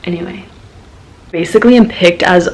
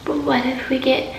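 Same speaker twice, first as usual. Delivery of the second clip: higher, soft dreamy whisper; dreamy romantic; 6 semitones higher, 7 dB quieter than usual. Retold or imitated. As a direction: swoon it like a daydream. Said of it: "my little brain goes into romantic mode".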